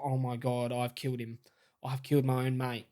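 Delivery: low, quiet imitation of dread; hushed dread. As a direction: voice a friend's horrified thought, low and soft. Voices his mate's horrified thought.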